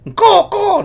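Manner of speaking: bird call. Acted. Imitates a seagull cry.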